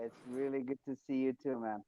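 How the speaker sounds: almost inaudible over the line; barely audible. Real, not acted. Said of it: "I can barely hear you".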